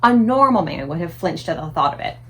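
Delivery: plain reading voice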